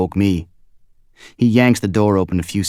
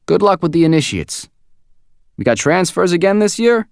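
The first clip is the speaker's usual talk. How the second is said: higher, lighter, friendlier boss voice; 11 semitones higher, 5 dB louder than usual. A narrator's reading. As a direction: ease up, now casually friendly. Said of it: "His voice lightens up a little".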